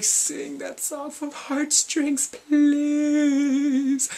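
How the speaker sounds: high, slow begging shout; pleading fan call. Retold or imitated. Fan begging the band to sing a song. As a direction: beg the stage loudly. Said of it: "I was like".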